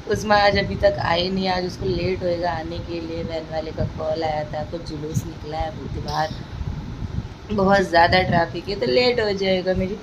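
mother speaking softly beside her sleepy son; hushed voice. Real, not acted. Quiet talk beside waking baby.

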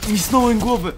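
frustrated. Real, not acted. Missed the headshot.

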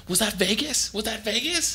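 high-pitched, put-on girlfriend voice; mock hysterical girlfriend. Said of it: "throwing a fucking, like, whole scene".

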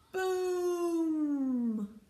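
high, long-drawn boom sliding down; booming outburst. A narrator's reading. Reader startles listeners with the bang, long booming cry. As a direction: let the word ring out and fall. Sudden big noise in the story.

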